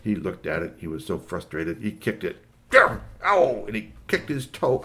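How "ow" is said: loud, high mock "ow"; sudden acted yelp; cry of pain. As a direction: yelp as if you stubbed a toe. Kicked mower, hurt toe.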